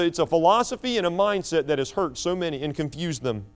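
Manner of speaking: normal talking voice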